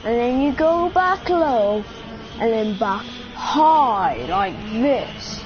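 slow, exaggerated TV presenter impression; Jeremy Clarkson. Shown as a demonstration. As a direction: stretch it out slowly, punching the key words. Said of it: "then you've got Jeremy Clarkson".

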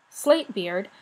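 ordinary delivery, nothing marked

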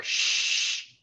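whispered shh like a silence falling; shushing. Acted. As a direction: hush the room with a long shh. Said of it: "emit a silence like".

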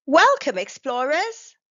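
put-on loud, bright call to the kids; excited welcome. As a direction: throw the greeting out with a big smile. Cheerful greeting to young explorers.

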